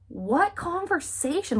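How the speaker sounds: high, drawn-out incredulous question; acted bafflement. Catherine has no memory of the talk, baffled. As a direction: stretch it out in mock disbelief.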